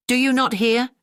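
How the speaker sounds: high shouted question to the deaf grandmother; loud cry. A narrator's reading. Landlord yelling at the silent old woman, loud. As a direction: call out loud to someone who can't hear. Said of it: "cried the landlord as loud as he could".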